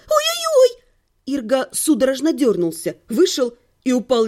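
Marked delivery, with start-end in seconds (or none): 0.1-0.8 high-pitched theatrical yelp; wailing. A narrator's reading. Wife faking first-time pain for her husband, wailing. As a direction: wail it high like sudden pain. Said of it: "и завопила".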